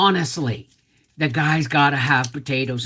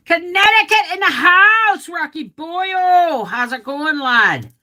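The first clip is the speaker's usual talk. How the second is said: high, loud announcer-like shoutout; hyped shoutout; 13 semitones higher, 6 dB louder than usual. Real, not acted. Streamer giving a hyped shoutout to viewer Rocky, high and loud. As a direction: hype the viewer up.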